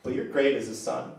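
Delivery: tender prayer. Acted. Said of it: "you're just like".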